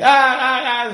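high, loud imitated child yelling "ah"; child's scream. Priest imitating a bored little boy screaming at home, loud high yells. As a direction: mimic a small kid shrieking to amuse himself. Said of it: "se le dio por gritar".

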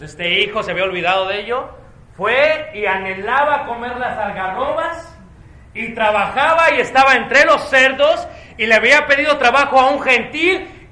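loud, high, forceful preaching; żarliwe kazanie. Real, not acted. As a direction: drive each phrase home to the congregation.

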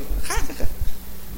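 airy high giggle; breathy high laugh of disbelief; Sara's laugh. Retold or imitated. Sara laughing at the angels' promise, airy and high. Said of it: "Y Sara se rió".

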